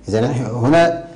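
ordinary talk, nothing marked

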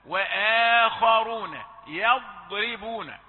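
وآخرون يضربون slow, loud; recitation. Shown as a demonstration. Teacher recites for students, drawn out. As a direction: chant وآخرون يضربون slowly. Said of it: "وليس".

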